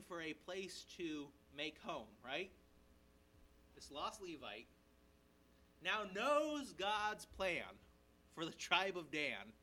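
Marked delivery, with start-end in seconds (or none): none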